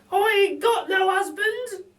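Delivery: very high, loud, slow wail; playing up her woe; Betty, mock dramatic. A narrator's reading. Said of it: "says Burglar Betty".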